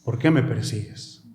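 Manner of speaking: low, measured call with pauses; voz del Señor. Acted. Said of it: "una voz que decía". (voz pausada y grave del Señor)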